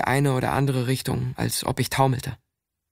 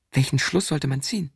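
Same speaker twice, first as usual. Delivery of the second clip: ordinary delivery, nothing marked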